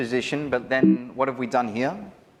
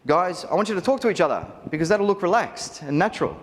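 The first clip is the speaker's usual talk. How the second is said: a lightly higher, louder cheesy photographer instruction; mocking typical photographer; 7 semitones higher, 3 dB louder than usual. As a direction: mimic the bland group-shot order, half-mocking. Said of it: "do I say to my groups, and I hear this all".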